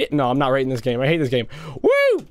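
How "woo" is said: high cheering whoop; real excited woo. Real, not acted. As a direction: cheer the shot. Cheers the hit.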